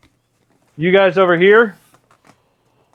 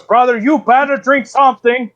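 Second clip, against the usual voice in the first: higher-pitched insistent bear; disdainful bear; 7 semitones higher than usual. Acted. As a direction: press a brother to drink, affronted. Bear disdains milk, urges drinking.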